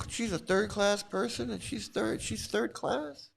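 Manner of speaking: high, soft, wounded protest; real upset, voice pitched high; hurt and disgusted. Real, not acted. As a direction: question it in pained disbelief. Hurt on behalf of own daughter.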